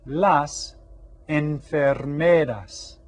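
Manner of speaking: "enfermeras" stretched slowly, syllable by syllable; slow model. Shown as a demonstration. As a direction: draw "enfermeras" out for learners. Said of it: "En español son".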